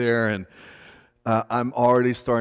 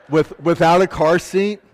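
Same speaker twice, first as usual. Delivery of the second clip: laughing through the words, rising higher and louder; 6 semitones higher, 9 dB louder than usual. Real, not acted. Pastor telling the congregation about the wrecker ride, laughing. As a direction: break into a laugh as you tell it. Son rode wrecker without car seat.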